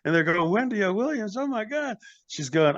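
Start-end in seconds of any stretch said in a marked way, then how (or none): 0.5-2.0 mock starstruck gasp. Band starstruck seeing Wendy.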